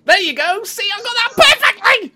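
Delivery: high, loud and pleased; excited. Real, not acted. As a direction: crow about it. Mock triumphant after mic fix.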